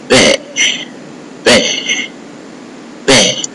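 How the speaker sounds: "bear" said as "beh", with no R; "bear" ending on an open vowel; open French E. Shown as a demonstration. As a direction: repeat "bear" as a model of the open E sound. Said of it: "letter E with other accent sound like".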